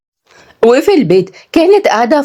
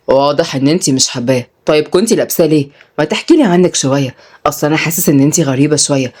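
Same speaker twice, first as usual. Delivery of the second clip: low, calm male character voice; curious young man; 5 semitones lower than usual. Acted. Tutor asks the nanny about herself, low and curious. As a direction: drop low, probe gently.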